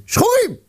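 שחורים said high and punched; שחורים with a sharp high accent; stress on שחורים. Acted. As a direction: hit שחורים hard and high.

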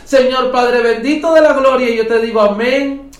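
fervent spoken prayer with rising emphasis. Acted. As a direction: pray it out aloud over the congregation. Live prayer over Facebook and radio.

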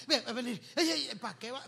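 mock scared moviegoer. Frightened moviegoer grabbing neighbour.

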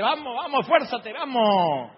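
loud, high straining shout, last word drawn out; hammed-up bossy urging. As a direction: holler orders while heaving a piano. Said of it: "el que va arriba dice".

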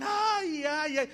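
high drawn-out fake ay cries; mock wailing. Preacher mimicking hired mourners, theatrical fake wails. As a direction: wail like a paid mourner, no tears. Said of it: "pero no derramaban ni una lágrima".

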